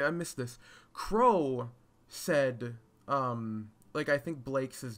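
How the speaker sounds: ordinary talk, nothing marked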